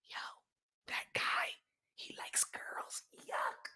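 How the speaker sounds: hushed, gossipy disgust; scandalized whisper. Acted. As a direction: whisper it slowly like a shameful secret. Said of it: "now you gonna have to whisper that shit".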